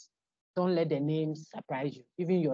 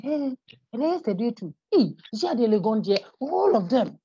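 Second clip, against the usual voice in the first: higher, louder, hammed-up exclaiming; mock alarmed gossip; 7 semitones higher, 4 dB louder than usual. Teacher imitating people jumping to conclusions about coronavirus, mock alarmed. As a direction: imitate someone spreading shocking news.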